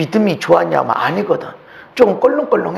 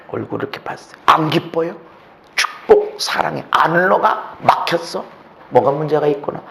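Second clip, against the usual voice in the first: normal talking voice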